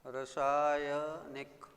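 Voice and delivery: रासायनिक said very slowly, syllable by syllable; slow careful word. Shown as a demonstration. Teacher to pupils, very slow careful रासायनिक. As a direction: spell out रासायनिक slowly with long first aa. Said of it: "रासायनिक होता है".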